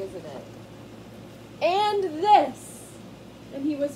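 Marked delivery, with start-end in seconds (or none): 1.6-2.8 high loud battle shout; battle cry. Acted. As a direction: yell it as you strike. Mouse fights grass.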